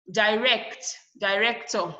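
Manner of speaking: Direct, director with RECT stressed. Shown as a demonstration. Teacher shows the base stress remains, RECT stressed in Direct, director. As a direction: put the force on the second syllable of Direct and director. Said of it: "The rex is still stress".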